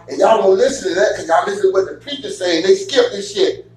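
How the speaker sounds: usual speaking voice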